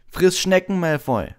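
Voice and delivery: loud, high furious insult; angry. A narrator's reading. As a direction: fling the insult hotly. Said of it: "sagte Ron zornig".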